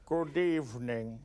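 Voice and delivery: deadpan host of a mystery show; Hitchcock. Acted. Host greeting the audience, in a low droll Hitchcock voice. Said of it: "Let's do some Hitchcock".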